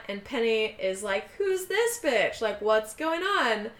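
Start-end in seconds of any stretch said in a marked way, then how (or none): 1.4-3.7 high, slow indignant voice; snippy outraged girlfriend; jealous. Retold or imitated.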